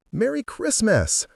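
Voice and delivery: high, slow, bright holiday hello; cheery parent greeting. A narrator's reading. Parent greeting visitors at the door, cheery. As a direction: welcome the girls in.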